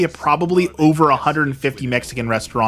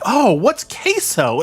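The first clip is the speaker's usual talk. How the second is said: high, slow, airy ditzy voice; mocking a clueless customer; 7 semitones higher, slower than usual. Vlogger imitating the restaurant lady, high and ditzy. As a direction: mimic her naive questions in a high voice. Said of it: "She's like".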